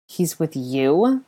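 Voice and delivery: slow, incredulous question; drawn out, rising in disbelief; appalled. A narrator's reading. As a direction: ask it aghast, stretching the words. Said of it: "looked even more appalled".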